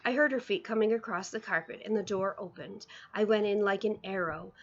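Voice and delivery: plain reading voice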